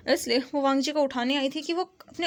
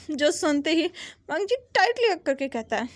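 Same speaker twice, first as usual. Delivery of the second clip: ordinary delivery, nothing marked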